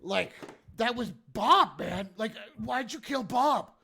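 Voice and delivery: high, loud, hammed-up reproach; aggrieved protest. Hobgoblin asking why they killed Bob, aggrieved.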